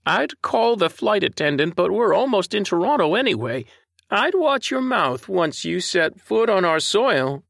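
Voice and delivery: put-on a grandmotherly scold with a slight Canadian accent; prim elderly Canadian lady. A narrator's reading. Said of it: "She had a slight Canadian accent".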